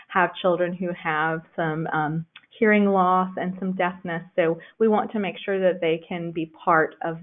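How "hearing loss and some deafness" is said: usual speaking voice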